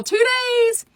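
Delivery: high, sing-out hype; TWO DAYS held high like an announcer's tease; excited call-out. Real, not acted. Calls out the start date again.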